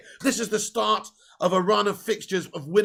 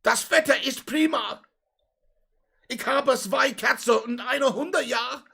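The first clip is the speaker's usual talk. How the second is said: slow, higher, hammed-up German speaker; German accent; 5 semitones higher, slower than usual. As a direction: play a jolly German manager. Mock German as Rangnick.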